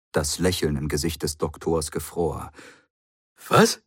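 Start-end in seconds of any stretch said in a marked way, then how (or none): 3.5-3.8 sharp, high, loud startled cry; jolted out of his calm; shocked what. A narrator's reading. The Doctor hears the Master's TARDIS is here, shocked loud.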